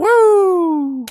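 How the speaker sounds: very high, loud woo; explosive hype whoop; loud whooping cheer. Real, not acted. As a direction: burst into a piercing whoop. Cheering the stream.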